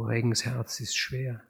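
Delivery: plain reading voice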